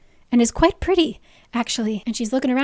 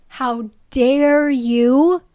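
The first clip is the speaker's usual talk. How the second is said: slow, loud, hammed-up indignation; drawn-out fake offence at skippers; mock outrage; 7 dB louder, much slower than usual. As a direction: scold the viewer theatrically. Teasing viewers who skipped ahead.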